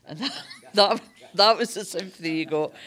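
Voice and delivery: laughing through words. Real, not acted. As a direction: chuckle as you say it. Laughing about sympathy.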